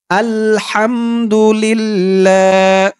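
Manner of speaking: slow, drawn-out chanted recitation; recited Arabic dua. Shown as a demonstration. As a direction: stretch every syllable like tilawat. Model recitation. (ধীর সুরেলা তিলাওয়াত)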